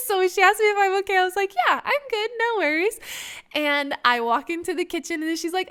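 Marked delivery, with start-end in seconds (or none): 1.5-3.0 high, sing-out "all good" cover-up; fake casual. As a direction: brush it off too brightly, pitched up. Covering up news to mom.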